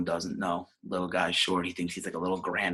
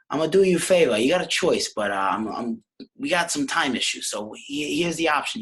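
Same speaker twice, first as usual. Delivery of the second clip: higher, louder mom voice; Nicky's ma; 7 semitones higher, 5 dB louder than usual. Acted. Ma offers her son a choice at home, acted mom voice.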